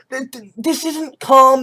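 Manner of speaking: normal talking voice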